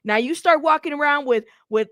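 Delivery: ordinary talk, nothing marked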